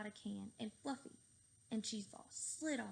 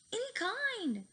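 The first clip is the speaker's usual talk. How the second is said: high, loud scream; 12 semitones higher, 6 dB louder, slower than usual. A narrator's reading. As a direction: scream it in panic. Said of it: "screamed Fluffy".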